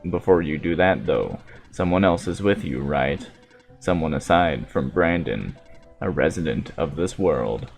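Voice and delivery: low, hushed android, quietly probing; softly solemn machine prophet; calm robot voice. Acted.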